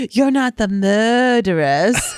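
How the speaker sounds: put-on slow, laughing English accent line; mimicked English accent. Fan imitating the actors' English accent, slow and laughing. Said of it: "The way that they said it".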